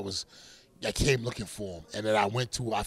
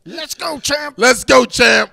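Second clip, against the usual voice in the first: high, loud shout of his catchphrase; 14 semitones higher, 18 dB louder, much slower than usual. Retold or imitated. Boxer reenacts yelling his catchphrase at a rival's press conference, full shout. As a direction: bellow it across the room at a press conference. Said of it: "we hear from the back of the room".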